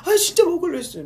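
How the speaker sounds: very high, loud squealed reply; shrill thrilled fan voice; excited fan. Retold or imitated. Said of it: "너무 많이 따라했네".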